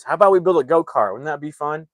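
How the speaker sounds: high, quick, eager suggestion; forced upbeat. Retold or imitated. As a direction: imitate a dad trying hard to sound fun. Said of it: "upbeat about it".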